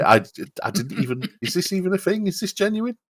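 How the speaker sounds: high-pitched, incredulous questioning; mock bafflement. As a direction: pitch climbs well up in acted disbelief. Acts out baffled reaction to questions.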